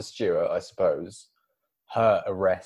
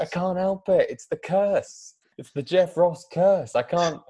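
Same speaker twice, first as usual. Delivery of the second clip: anguished lament; 8 semitones higher than usual. Acted. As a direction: wail it like a cursed hero. Jeff Ross lamenting his curse.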